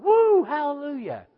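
high whooping cheer; mock crowd whoop. Preacher imitating men cheering at a stadium, high whoop.

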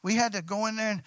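normal talking voice

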